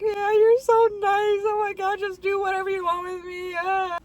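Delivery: high, fast, gushing eagerness; gushing like a loser. Retold or imitated. To the kind doctor, high gushing surrender. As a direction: gush all over the nice doctor. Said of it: "Like a loser".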